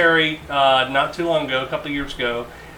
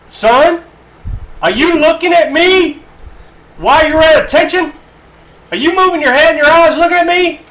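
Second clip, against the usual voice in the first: much higher, much louder drill sergeant yell; 11 semitones higher, 9 dB louder than usual. Retold or imitated. Drill sergeant yelling at a recruit who turned his head, full yell. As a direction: scream it in the recruit's face. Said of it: "He says".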